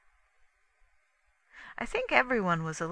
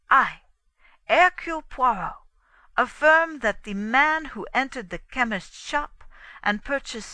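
emphatic, raised oratorical delivery; declaiming detective; 4 semitones higher, 7 dB louder than usual. A narrator's reading. Poirot announcing his proof to everyone, declaiming. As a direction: proclaim it to the whole room.

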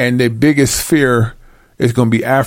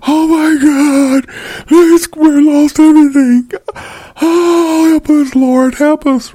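much higher, louder, slow wailing cry of terror; screaming in horror; 4 dB louder, slower than usual. Retold or imitated. A ruined city crying to God in horror, high, slow wailing. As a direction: wail to the Lord as if everything is lost. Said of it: "Alice is screaming out in horror".

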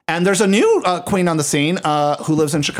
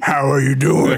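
out-of-drag voice. Retold or imitated. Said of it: "when she gets out of her drag voice".

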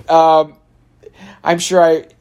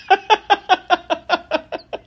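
bursts into a high, real laugh; amused high-pitched laugh. Real, not acted. Amused by a silly question.

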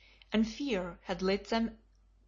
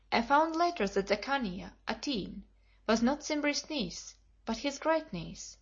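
ordinary delivery

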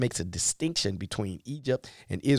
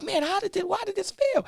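puzzled; 4 dB quieter than usual. Retold or imitated. Puzzled person after failure.

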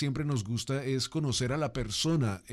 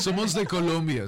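laughing; 5 semitones higher, 4 dB louder than usual. Real, not acted. Laughing answer to accent guess.

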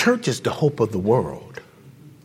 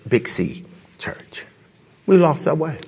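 ordinary talk, nothing marked

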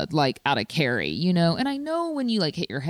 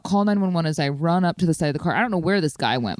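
ordinary talk

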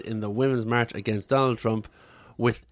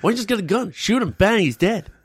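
mock excited; 6 semitones higher, 4 dB louder than usual.

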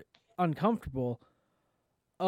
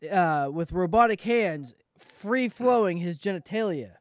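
ordinary talk

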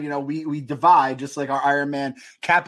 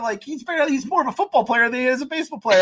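excited rant; 9 semitones higher than usual. Real, not acted. Excited rant about Aaron Judge.

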